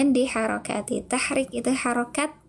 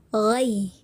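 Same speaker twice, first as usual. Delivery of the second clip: "Ghai" stressed and held, gliding down lower; nabar demo; 3 semitones lower than usual. Shown as a demonstration. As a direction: press on "Ghai" as one held syllable. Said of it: "ada penaikan sedikit intonasinya". ("Ghai" ditekan dengan nabar)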